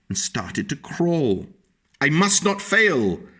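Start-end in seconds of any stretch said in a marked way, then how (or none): 2.0-3.3 tiny snail determined. A narrator's reading. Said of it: "said the tiny snail".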